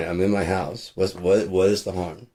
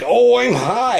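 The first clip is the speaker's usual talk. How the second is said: mock dopey stoner; 5 dB louder, much slower than usual. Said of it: "and be like".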